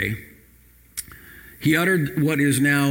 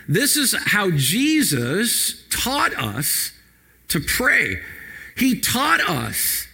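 impassioned preaching; much slower than usual. Acted. Pastor urging the church to pray this verse, impassioned. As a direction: stretch every phrase to stir the room.

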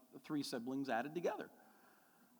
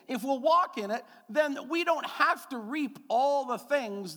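much louder and higher, slow; full-force preaching; 10 semitones higher, 7 dB louder than usual. Acted. Pastor proclaims grace to the congregation, loud preaching.

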